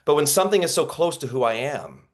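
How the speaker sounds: normal talking voice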